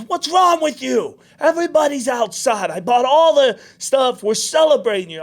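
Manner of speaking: high, loud imitation of dad; exasperated dad. Dad to crying son after the concert, exasperated retold shout. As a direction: plead loudly in mock parental disbelief.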